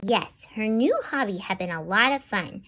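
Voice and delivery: gentle read-aloud storytelling voice; storybook narrator for kids; story narration. Acted. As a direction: read it to young children. Reads picture book aloud.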